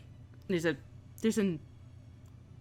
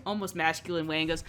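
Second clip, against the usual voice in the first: ordinary delivery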